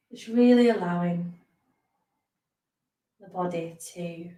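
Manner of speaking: unhurried meditation teacher, leaving space between phrases; guided meditation. Acted. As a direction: ease listeners into rest, phrase by phrase. Leads a guided body scan.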